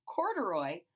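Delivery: ordinary delivery, nothing marked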